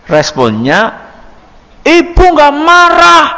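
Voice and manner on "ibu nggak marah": high, drawn-out mock yell of a mother; louder mock denial. Mother accused by her child of being angry, loudly protesting. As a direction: deny it in a raised, exasperated mother's voice. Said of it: "malah tambah nopo? Seru".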